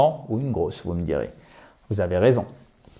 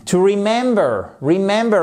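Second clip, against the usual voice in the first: "remember" with a high-pitched stressed MEM; second-syllable stress; 5 semitones higher than usual. Shown as a demonstration. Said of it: "l'accentuation sur la seconde, la deuxième syllabe". (« remember » accentué sur la deuxième syllabe)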